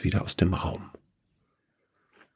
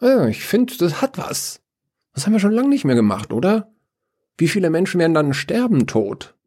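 much higher, fast, eager voice; excited Evil; 10 semitones higher, faster than usual. A narrator's reading. Evil asking Death about casualties, excited. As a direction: thrill at the idea, racing through it. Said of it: "fragt das Böse aufgeregt".

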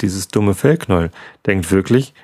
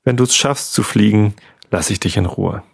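plain reading voice

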